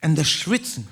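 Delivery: ordinary talk, nothing marked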